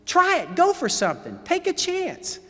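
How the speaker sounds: high mock fan shouting; fans yelling. Coach voicing fans urging a risky play. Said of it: "you would have said to me".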